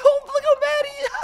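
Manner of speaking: high-pitched giggle over the words; laughing high. Real, not acted. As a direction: laugh out loud at how mad he is. Laughs at an angry villager.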